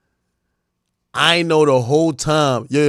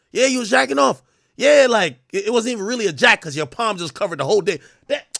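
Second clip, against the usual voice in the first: ordinary talk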